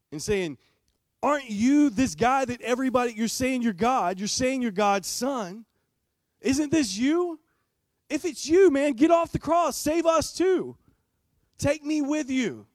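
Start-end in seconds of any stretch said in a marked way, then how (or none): 1.2-7.4 higher, louder pleading from the cross; dying criminal cries out to Jesus, higher and pleading; desperate criminal. Acted. 8.1-12.7 high, loud shouting plea; desperate shout. Acted. Criminal begs Jesus to save him.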